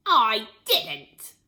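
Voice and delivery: put-on loud cackle of an old woman; cackling. A narrator's reading. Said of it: "cackled the old woman".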